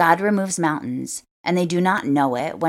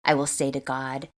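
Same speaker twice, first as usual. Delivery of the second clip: usual speaking voice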